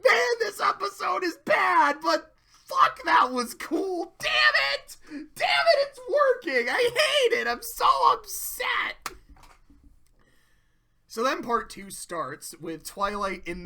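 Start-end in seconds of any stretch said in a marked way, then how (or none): 0.0-5.0 high, loud excited cry; excited outburst. Real, not acted. 5.3-9.1 high, laughing frustrated outcry; mock upset. Real, not acted.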